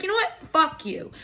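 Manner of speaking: imitating her own bold comeback; mock defiant snap. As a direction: fire it back at the snobby crowd. Snapping back at judgmental concertgoers.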